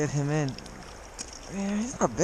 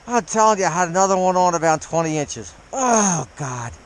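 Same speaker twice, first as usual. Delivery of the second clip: excited; 3 semitones higher, 7 dB louder than usual. Real, not acted. Excited about losing big fish.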